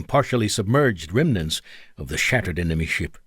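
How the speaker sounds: usual speaking voice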